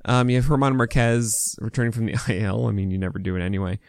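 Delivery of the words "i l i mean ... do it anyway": normal talking voice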